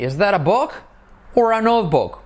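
high intonation model. Shown as a demonstration. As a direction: lift the question up high. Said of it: "So repeat after me".

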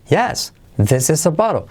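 high YES, then BOTTLE peaks high and falls; affirmative intonation. Shown as a demonstration. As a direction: lift "bottle" to the pitch of "yes", then drop. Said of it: "Y la entonación sería Yes". ("yes" alto y "bottle" sube igual, luego cae)

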